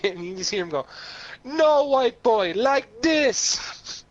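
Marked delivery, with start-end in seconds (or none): 1.4-4.0 loud, high, drawn-out Jamaican shout; Jamaican accent. Retold or imitated. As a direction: call it out in a mock Jamaican accent. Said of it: "had this very distinct Jamaican accent".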